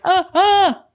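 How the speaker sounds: high yelping squeaks; a short scared squeal; imitated yelp. Mocking tough guys startled, high yelps. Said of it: "They're like".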